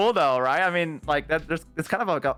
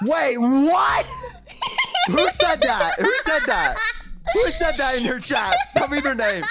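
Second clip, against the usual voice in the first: playfully furious, pitched way up; mock jealous outrage; 11 semitones higher than usual. Real, not acted. Demanding to know who in chat said it, high laughing outrage.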